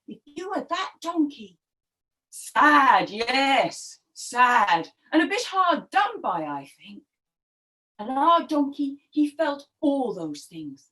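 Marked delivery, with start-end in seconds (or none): none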